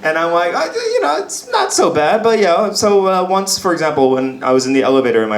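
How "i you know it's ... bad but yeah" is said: coy modesty. Retold or imitated. Acted modest reply to admirers.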